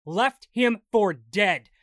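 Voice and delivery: each word weighed, slow and bitter; slow angry repeat. Acted. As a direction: drag out the repeat in anger. Repeats phrase slower, heavier.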